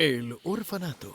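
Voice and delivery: announcer voice naming the title; hard sell ad. Pretend. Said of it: "do your commercial voice".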